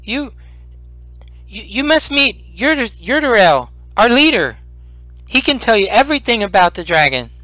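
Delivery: high stammering creature voice, pressing; little creature urging them to its leader; urgent kobold. Acted. Kobold Meepo urging the party to meet Yurdarel, high and pressing. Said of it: "he says".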